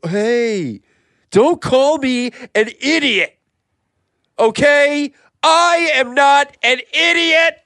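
high, slow, indignant declaration; offended outburst. Acted. Podcaster to co-host who called him idiot, offended. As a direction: push each word out high in outrage.

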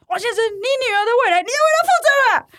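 high, fast shrill yell through the door; yelling from outside. Retold or imitated. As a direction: shout the warning from outside the house. (妈妈在门外高声叫喊)

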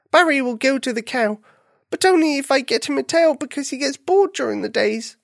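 high-pitched, plaintive little bunny; upset bunny. A narrator's reading. Bunny telling his friends the whole story, plaintive. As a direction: pour out the whole trouble in a high whine. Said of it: "Buffy wailed to his friends".

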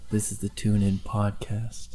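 soft faint ghostly voice; dreamy distant voice. Pretend. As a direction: whisper it like a distant presence. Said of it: "and it says".

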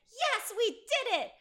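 high, slow, gleeful cheer; mock-triumphant accomplices. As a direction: crow it in victory. Accomplices celebrating.

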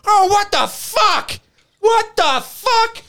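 very high, slow drawn-out yell; imitating Corey yelling. Corey in the dark river, yelling in shock, very high drawn-out. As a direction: wail it into the dark. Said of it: "all of a sudden I hear".

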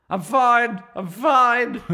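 slightly slurred, tipsy; slurred drunk voice. Acted. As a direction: slur it a little like you're drunk. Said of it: "doing a slightly slurred voice".

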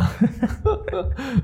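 a sudden high burst of laughter. Real, not acted. Co-host laughing at the bank robbery story. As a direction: laugh up high, amused by the joke.

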